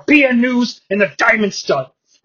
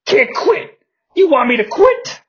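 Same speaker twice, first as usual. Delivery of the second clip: higher, flamboyant wrong voice; wrong character voice; 10 semitones higher than usual. Acted. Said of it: "Ron Simmons is actually the one who says".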